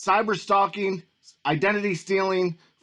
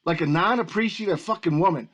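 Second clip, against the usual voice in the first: ordinary talk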